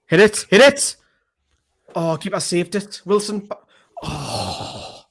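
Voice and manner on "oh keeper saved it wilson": ordinary delivery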